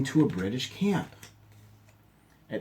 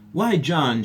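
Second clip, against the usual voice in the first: higher, louder happy surprise; surprised; 6 semitones higher, 7 dB louder than usual. A narrator's reading. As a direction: caught off guard at seeing his brother. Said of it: "Charles said in surprise".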